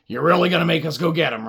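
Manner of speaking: ordinary delivery, nothing marked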